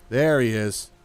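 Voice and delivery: ordinary talk, nothing marked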